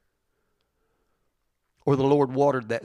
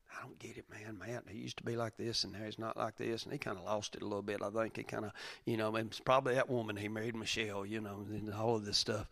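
skeptic; 3 semitones lower, 13 dB quieter than usual. Retold or imitated. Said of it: "Anybody ever been skeptical of your faith?".

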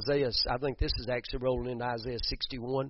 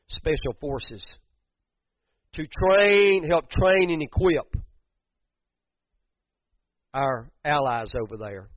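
rising preacher's voice, louder on train; preaching; 3 semitones higher, 7 dB louder than usual. Acted. Preacher telling the church about fifty soldiers, building loud.

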